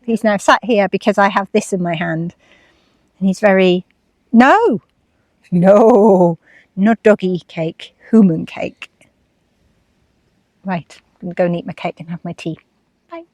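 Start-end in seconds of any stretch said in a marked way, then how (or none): 4.3-8.9 drawn-out no to a pet; firm telling-off to dog. Real, not acted. Owner keeping the dog off her cake, firm scolding. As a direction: warn the dog off the food.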